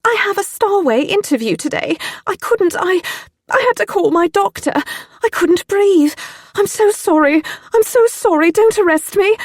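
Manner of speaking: very high, loud sobbing plea; tearful panic. Acted. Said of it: "I said through the tears".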